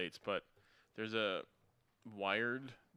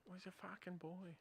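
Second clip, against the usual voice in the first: high, soft mock Boston accent; imitated Boston accent, pitched up; attempted Boston accent; 9 semitones higher, 12 dB quieter than usual. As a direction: quietly echo the quote in Boston accent. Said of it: "trying to do the accent".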